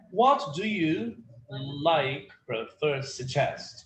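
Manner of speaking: slow drill for repeating. Shown as a demonstration. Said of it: "student repeats after teacher".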